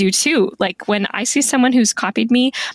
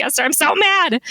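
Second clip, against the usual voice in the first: high mock-angry complaint; mad; 8 semitones higher than usual. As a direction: complain in a higher voice. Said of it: "I'm like".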